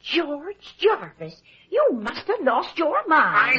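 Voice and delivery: slow, pressing rebuke of her husband; shocked scolding. Acted. Wife scolding husband for refusing the buyer, shocked and slow. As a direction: say each word as if he's gone mad.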